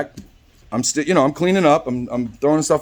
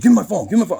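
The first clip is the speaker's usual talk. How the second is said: high-pitched insistent demand; acting out an aggressive customer; pushy retold demand, voice pitched up; 6 semitones higher, much faster than usual. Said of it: "like, all aggressive".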